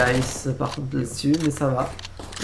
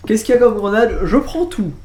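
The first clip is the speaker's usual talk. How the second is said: excited shout; 5 semitones higher, 8 dB louder than usual. Real, not acted. Big play, caster erupts.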